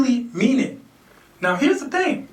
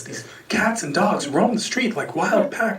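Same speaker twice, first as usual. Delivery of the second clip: ordinary talk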